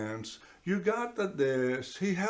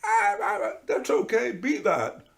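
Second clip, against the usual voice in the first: high, loud wailing whimper that settles; mock crying lamb; 12 semitones higher, 5 dB louder than usual. Speaker acting out the lamb at the bottom crying out, high mock wail. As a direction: cry out like a lost lamb, then soothe. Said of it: "I don't want you to think I'm making fun of this one".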